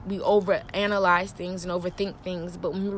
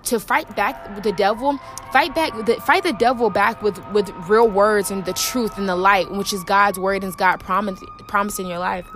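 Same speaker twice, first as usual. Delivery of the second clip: louder and louder sermon; rising preaching; 6 dB louder than usual. Real, not acted. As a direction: build the call to fight into a loud climax. Preaching builds to climax.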